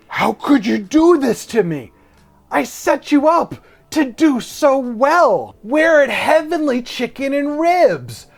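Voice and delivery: high, loud, drawn-out wail of betrayal; anguished booker. Retold or imitated. Booker to the bombing comic from the audience, anguished. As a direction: plead loudly, stretching the words in mock anguish. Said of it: "with his eyes that's just saying".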